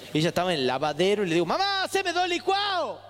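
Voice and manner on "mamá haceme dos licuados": high, drawn-out call for mom. Acted. Son calls to mom in the laundry, high drawn-out call. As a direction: act out a kid hollering across the house. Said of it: "Yo tenía un externo que era mi voz".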